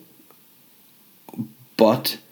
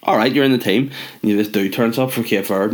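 ordinary delivery

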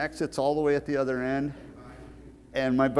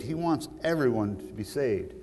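ordinary delivery

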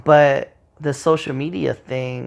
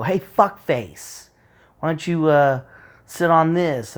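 higher-pitched mock taunt; confrontational; 4 semitones higher, 4 dB louder, slower than usual. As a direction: pretend to pick a fight with an insult. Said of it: "we don't get confrontational".

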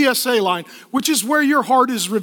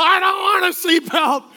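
put-on whining kid refusing; 7 semitones higher than usual. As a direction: push back like a stubborn child. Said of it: "the parents are like".

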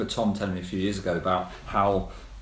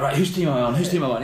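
put-on loud, high, eager kid asking to play; a buzzing kid desperate to get picked; excited kid voice; 5 semitones higher, 6 dB louder, faster than usual. Kid arriving eager to join game.

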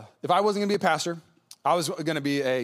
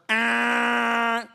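a short, higher buzzer honk; buzzer imitation; 4 semitones higher, 3 dB louder than usual. Pastor imitating the game-ending buzzer with a honk. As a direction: mimic the final buzzer sounding.

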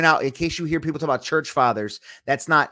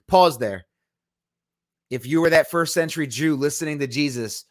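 ordinary delivery

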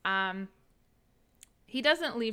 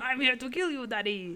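put-on French accent; 4 semitones higher than usual. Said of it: "French accent for little Philippe".

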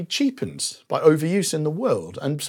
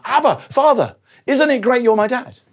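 high, louder, joyful exclamation; cry of celebration; 10 semitones higher, 5 dB louder than usual. Acted. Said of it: "as a cry of celebration".

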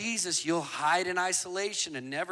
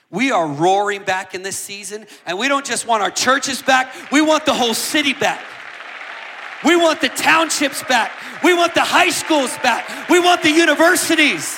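louder and higher, driving rally cry; preacher rallying the congregation, full-force shout; full-force preaching; 7 semitones higher, 12 dB louder than usual. Acted. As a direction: build each "we want" line to a shout.

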